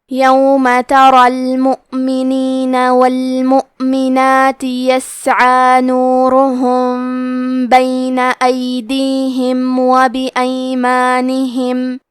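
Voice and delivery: slow, drawn-out melodic tilawat; Quran recitation. Acted. Tajweed teacher recites the verses for students, slow melodic chant.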